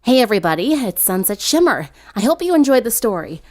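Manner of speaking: loud, bright sign-off to viewers; upbeat outro. Real, not acted. The narrator thanking her audience, loud and upbeat. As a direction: wrap up the video cheerfully.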